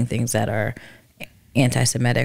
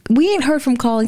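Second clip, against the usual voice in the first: exasperated; 12 semitones higher, slower than usual. Real, not acted. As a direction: push back, half laughing. Rejecting friend's suggestion.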